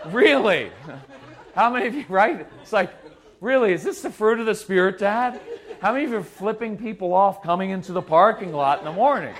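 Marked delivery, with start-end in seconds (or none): 0.1-0.7 loud, disbelieving mock question; incredulous kid. Kid to Dad on the way to church, incredulous. Kid reacting to parent, acted. 3.4-5.4 higher, louder mock disbelief; incredulous kid. Kid to Dad on the way to church, sarcastic disbelief.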